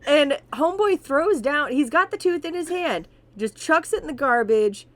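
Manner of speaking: loud, high, building storytelling; excited. Real, not acted. Telling his cohost the wild part, excited and loud. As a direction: raise it up as the story peaks.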